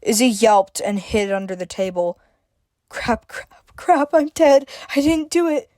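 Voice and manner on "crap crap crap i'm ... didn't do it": panicked whimper; higher, frightened and whimpering. A narrator's reading. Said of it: "Izzy whimpered".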